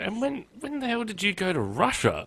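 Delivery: high-pitched incredulous retold mother. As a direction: demand it in high-pitched disbelief. Retelling mother's incredulous question.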